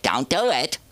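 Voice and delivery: lightly voiced, high-pitched mother's warning; high mock scolding. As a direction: imitate mom telling you off, pitch climbing. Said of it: "she told me not to do it".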